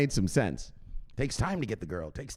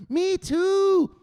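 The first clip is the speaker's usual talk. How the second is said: put-on very high, louder excited girl voice; squeaky girlish reply; 5 dB louder than usual. Teresa agreeing happily, very high squeaky voice. Teresa answers in acted dialogue.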